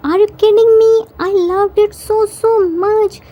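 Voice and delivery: high, excited delight; delighted gushing. A narrator's reading. Jimin answering whether he liked his surprise, gushing delight.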